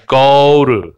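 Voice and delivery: "go to" run together as "go-ru"; fast linked pronunciation. Shown as a demonstration. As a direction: soften the t in "go to" to a tapped r. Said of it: "얘를 빨리 발음하면".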